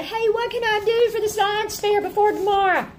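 put-on high, whiny kid asking late; a kid begging at the last minute; panicked kid voice.

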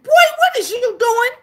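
high, loud, cracking disbelief; outraged grandma. Retold or imitated. Grandma to her son, high and loud in disbelief. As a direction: let the outrage break through.

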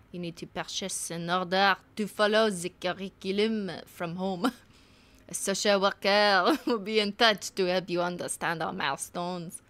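put-on English read with a thick French accent. School commission writing to a mother, French accent.

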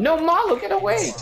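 high shrieking plea; panicked yell. Real, not acted. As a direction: shout it in a panic. Yelling at teammate in game.

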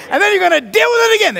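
high, fast, loud outburst; hammed-up high-pitched frustration; exasperated mock shout. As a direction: break in with a frantic yell. Acts frustration between sung refrains.

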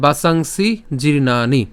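slow বাসাংসি জীর্ণানি with held long syllables; measured teacher's model of বাসাংসি জীর্ণানি; Sanskrit pronunciation demo. Shown as a demonstration. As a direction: recite বাসাংসি জীর্ণানি slowly, jeer-naa-ni. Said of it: "কি উচ্চারণ হবে".

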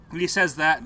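ordinary delivery

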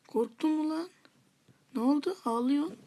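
high, loud, goading taunt. Real, not acted. Player goading a rival in a fight, taunting.

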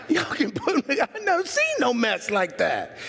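high, fast through a laugh; amused high laughing line. Real, not acted. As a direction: laugh it out in disbelief. Laughing at cussers.

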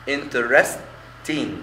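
"interesting" very slow; syllables drawn out. Shown as a demonstration. Teacher counting syllables aloud to students. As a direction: stretch out "interesting" beat by beat. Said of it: "أدي مقطعين".

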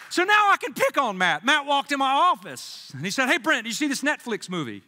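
loud, high and eager; excited teasing. Acted. Pastor to the church, eager teasing build-up. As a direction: pick on Matt with big energy.

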